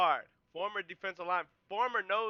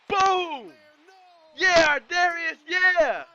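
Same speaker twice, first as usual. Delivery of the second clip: much higher, louder Boom and Yeah shouts; ecstatic cheering; 11 semitones higher, 13 dB louder, much slower than usual. Real, not acted. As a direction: cheer the hit like you're in the stands. Cheering a big defensive hit.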